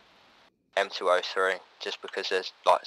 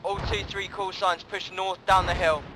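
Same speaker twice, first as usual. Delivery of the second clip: raised commanding call; calling out orders; 8 semitones higher than usual. Real, not acted. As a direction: shout it to the whole squad.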